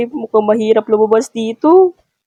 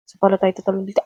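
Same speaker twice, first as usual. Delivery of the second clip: ordinary talk, nothing marked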